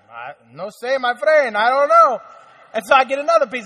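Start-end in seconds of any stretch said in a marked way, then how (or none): 0.1-2.2 playful fake Spanish accent, getting louder. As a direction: bluff innocence in Spanish accent. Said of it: "could be anybody's people".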